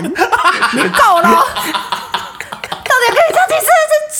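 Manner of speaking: high, laughing mock-angry snap; hammed-up exasperation, squeaking high; fed-up outburst. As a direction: replay the friend losing it, half laughing. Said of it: "直接跟他闹翻".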